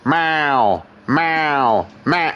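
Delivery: long, falling yowled meow; nagging cat, each meow drawn out; imitating a cat's meow. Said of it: "you're like".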